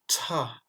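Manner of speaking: "Cha" as "tcha" with a strong puff; breathy burst before the vowel in "Cha"; aspirated CH. Shown as a demonstration. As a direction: push air out after the tch of "Cha". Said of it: "suivi d'une expiration".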